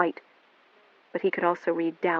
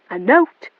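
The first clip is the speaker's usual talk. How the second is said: sharp, louder; angry disbelief; 4 semitones higher, 8 dB louder than usual. A narrator's reading. William snapping back at Melanie. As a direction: snap the question back.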